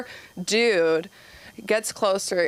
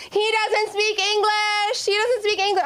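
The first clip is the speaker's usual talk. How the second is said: put-on chorus of kids; 14 semitones higher than usual. Kids telling the teacher about a classmate, very high sing-song. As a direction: chant it like know-it-all kids. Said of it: "all the other kids go".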